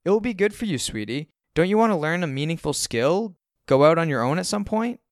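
sweetly encouraging mom voice; coaxing mom. A narrator's reading. Mom voiced, coaxing her son.

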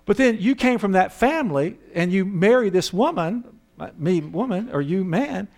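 normal talking voice

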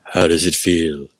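slow, stately British orator; Felix Dennis. Retold or imitated. Imitating publisher addressing the hushed party, slow grand British voice. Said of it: "James Earl Jones British voice".

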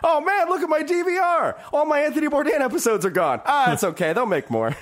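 high, wide-eyed mock amazement; hammed-up bewildered traveler; clueless returning passenger. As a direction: play the dazed guy stepping off the plane. Continues returning passenger bit.

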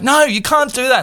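high-pitched retold plea; distressed protest. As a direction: imitate a panicked child protesting. Retold protest to mother, then crying.

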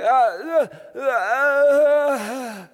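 high, loud anguished wordless wail; loud crying groan of a soul with no words. Retold or imitated. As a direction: let out a raw, pained cry with no words. Wordless groaning prayer acted out.

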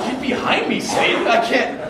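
high, loud mock-dramatic refusal; mock rebuke. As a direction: banish the tempter, half laughing. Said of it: "And I'm like".

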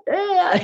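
a strained, high-pitched "ah" of despair; mock anguished ah; high frustrated groan. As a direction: groan out your stuckness. Frustrated sigh-groan in retold moment.

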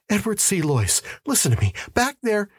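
high, urgent pleading; anxious, pitch leaping up; agitated insistence. Acted. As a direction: beg to be heard by the police. Distraught man urging police to listen.

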